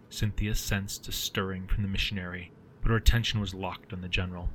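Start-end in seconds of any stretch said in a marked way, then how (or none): none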